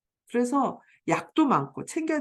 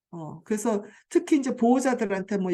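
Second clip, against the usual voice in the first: usual speaking voice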